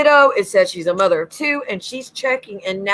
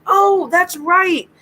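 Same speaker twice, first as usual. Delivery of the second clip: sudden realization; 9 semitones higher, much slower than usual. Retold or imitated. Client remembering in retold reading.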